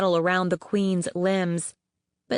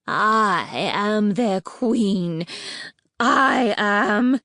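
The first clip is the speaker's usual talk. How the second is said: spat; 2 semitones higher, 3 dB louder, much slower than usual. Acted. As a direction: bite out each word with contempt. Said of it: "she spat".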